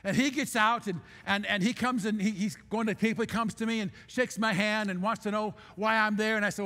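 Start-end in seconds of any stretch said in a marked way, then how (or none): none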